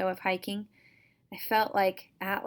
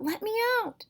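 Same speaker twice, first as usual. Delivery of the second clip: high, strained cry from the sack; a trapped thing screaming to get free; muffled voice shrieking from inside a sack; harsh cry. Acted. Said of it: "the voice cried out".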